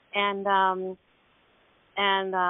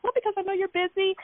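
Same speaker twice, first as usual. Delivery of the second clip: sheepish excuse; 8 semitones higher, faster than usual. Retold or imitated. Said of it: "I was like".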